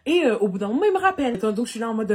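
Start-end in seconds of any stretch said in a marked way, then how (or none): none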